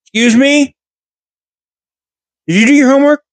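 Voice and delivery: high, indignant teacher voice; a teacher who can't believe her ears; shocked teacher. Retold or imitated. Teacher demanding an answer from the rude pupil, high and indignant.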